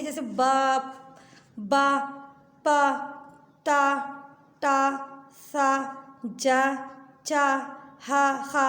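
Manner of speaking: single sounds spaced out very slowly; letter sounds. Shown as a demonstration. Teacher reviews the letter sounds for children, slow. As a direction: give each sound on its own. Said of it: "इसकी sound आपको अगर याद हो जाएगी".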